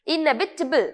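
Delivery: इनएविटेबल ending in a short "tibul"; correct pronunciation. Shown as a demonstration. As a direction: model इनएविटेबल as "in-EV-i-tuh-bul". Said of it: "टिबल आपके pronunciation में आना चाहिए". (इनएविटेबल का सही रूप, अंत में छोटा "टिबल")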